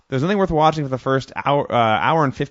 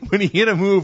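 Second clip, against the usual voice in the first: usual speaking voice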